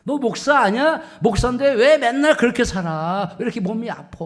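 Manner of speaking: loud, high jeering questions; mocking challenge. Scoffer taunting a pastor over his poor health, mocking. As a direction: badger him like a scoffer. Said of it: "조롱하고 비아냥거리는 거예요".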